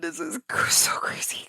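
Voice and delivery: whispered through tears; teary whisper. Retold or imitated. Crying gamer alone at midnight, whispering in awe. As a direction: barely voice it, overcome. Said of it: "I start crying".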